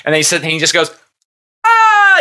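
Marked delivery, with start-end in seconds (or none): 1.6-2.2 loud, high-pitched mock "aah!"; imitated laughing cry. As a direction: shriek it out, imitating a man laughing. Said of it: "He's, like, laughing".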